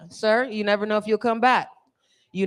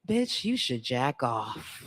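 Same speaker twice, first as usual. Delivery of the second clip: low, slow, lazy suggestion; slow stoned inner voice; 6 semitones lower, 6 dB quieter, much slower than usual. Retold or imitated. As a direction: drag it out like a stoned thought. High mind talking.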